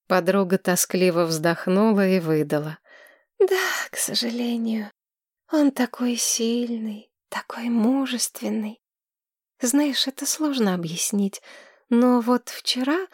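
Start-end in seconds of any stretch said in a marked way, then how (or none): 3.4-4.9 put-on high, breathless girl gushing in love; dreamy young woman. Tamira gushes over her healer. 5.5-8.8 breathy swooning whisper, high. Acted. Swooning over him, whispered.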